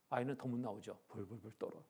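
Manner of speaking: ordinary delivery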